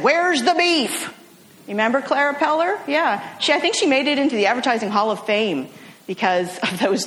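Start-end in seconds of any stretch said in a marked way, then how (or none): 0.0-1.1 put-on slow, raised old-lady voice demanding the beef; feisty old lady. Little old lady at the counter questions her burger, slow cranky voice. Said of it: "a little old lady looked at her hamburger and said".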